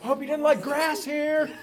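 high, gloating taunt. Acted. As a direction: needle the neighbor with spite. Leroy taunting neighbor.

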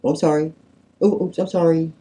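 high, offhand mock apology; insincere apology. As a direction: toss off a sorry you don't mean. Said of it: "we don't mean it".

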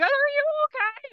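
high mock-concerned "are you okay"; concerned stranger. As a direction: mimic an overly concerned passerby. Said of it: "the woman who's like".

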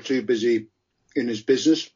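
talking while crunching ice; words round a chunk of ice; ice in mouth. Real, not acted. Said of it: "Sorry, I'm crunching on an ice cube".

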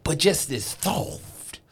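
slow dramatic eulogy voice; hammed-up heartfelt tribute, slowing down; mock eulogy.

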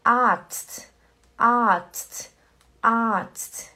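Arzt said slowly with a long a. Shown as a demonstration. Teacher drilling Arzt for her class. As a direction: draw out the a in Arzt. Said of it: "que é um A longo".

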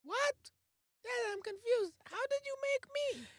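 put-on confused kid voice. Son puzzled by his dad, high kid voice.

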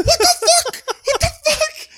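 high, loud shocked cry; shocked disbelief. Retold or imitated. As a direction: yelp it out, as if you just saw a crash. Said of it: "I was like".

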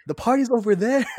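high, loud mock voice of a drunk friend; imitating drunk William. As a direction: squeal it up high like a wasted buddy. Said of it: "And he goes".

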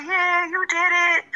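high excited cheer, imitating the crowd; mock cheering. Retelling friends at her graduation, high mock cheer. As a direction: cheer it like a proud fan at graduation. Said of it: "screaming the loudest".

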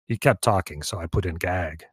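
matter-of-fact heavy, voice dropped low; thug henchman voice. Acted. Gregor explains the gag.

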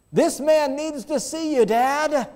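put-on high child's pleading; boy pleading for the man; little boy voice. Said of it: "boy asks father".

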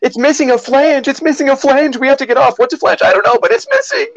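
high-pitched panicked sitcom passenger; Phoebe. Acted. Passenger freaking out about the plane, in high mock panic. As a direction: raise a hammed-up alarm, very high and urgent. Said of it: "all of the lines from Phoebe and Friends".

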